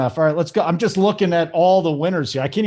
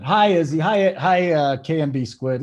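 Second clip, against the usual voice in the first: normal talking voice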